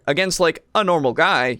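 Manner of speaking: usual speaking voice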